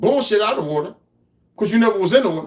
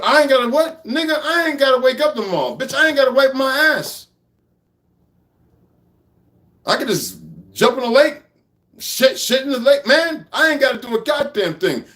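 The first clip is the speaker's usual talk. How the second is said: heated rant; 6 semitones higher than usual. Real, not acted. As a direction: fire it back at him, indignant.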